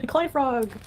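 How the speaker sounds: delighted exclamation through a laugh; excited laugh. Real, not acted. Laughing recognition.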